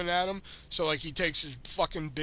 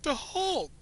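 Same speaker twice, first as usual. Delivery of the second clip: high-pitched desperate cry of the name; nearly in tears, pleading it's the Hulk; exasperated cry; 10 semitones higher than usual. Retold or imitated. Clerk near tears insisting to customers, high desperate cry. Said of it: "I'm almost in fucking tears".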